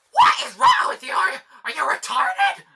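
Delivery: high, loud imitation of a screaming mother; screaming shout. Retelling mom yelling over a failed cartwheel, screamed imitation. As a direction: scream it in full force as the enraged parent. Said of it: "she would scream at me and just shout like".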